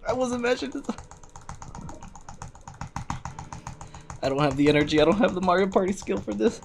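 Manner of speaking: high, loud panicked yelling; panicked screaming. Real, not acted. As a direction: scream in real panic as it slips away. Panicking as game character dies.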